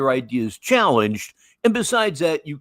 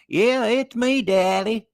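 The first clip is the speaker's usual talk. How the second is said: put-on high-pitched daughter in a thick holler accent; [name], Kentucky holler accent; 9 semitones higher than usual. Daughter answering her puzzled dad, thick Kentucky accent. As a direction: mimic a girl's heavy Kentucky hill accent, pitched high. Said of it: "that accent just took her over".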